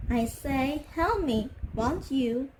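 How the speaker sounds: ordinary delivery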